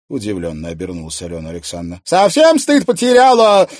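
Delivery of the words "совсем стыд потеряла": high, loud angry shout; drunken furious yell; furious bark. A narrator's reading. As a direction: bark the insult at her. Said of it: "гаркнула Анфиса".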